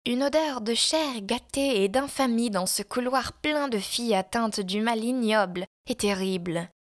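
usual speaking voice

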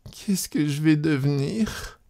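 tearful lament. A narrator's reading. Abandoned wife thinking aloud as she cries, tearful. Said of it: "elle se mit à pleurer".

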